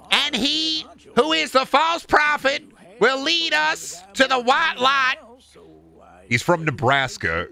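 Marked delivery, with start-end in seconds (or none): 0.1-5.2 high, ringing zealot proclamation; mock prophecy. Zealot foretelling the false prophet's path, mock prophecy. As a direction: declaim it high like a hammed-up preacher.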